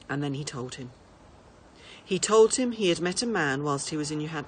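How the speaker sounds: ordinary delivery, nothing marked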